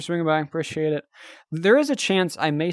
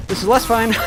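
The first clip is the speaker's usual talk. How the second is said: rising panic; 7 semitones higher, 6 dB louder than usual. Pretend. Fight going badly.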